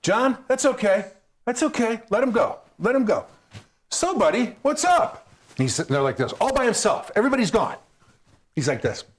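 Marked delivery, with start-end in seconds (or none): 0.0-3.2 high, gentle calming voice; calm the bouncer down gently; soothing. Retold or imitated. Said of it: "and I go". 3.9-5.3 high friendly coaxing. Retold or imitated.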